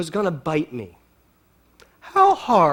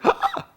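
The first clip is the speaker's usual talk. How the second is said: a sharp, high startled shriek; startled gasp. Retold or imitated. Startled by a snake on the trail, sharp high shriek. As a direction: jump at the snake with a yelp.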